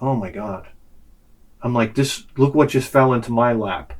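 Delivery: low, soft acted awe; scheming aside. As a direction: play marvelling privately at your luck. Scheming aside to himself in story.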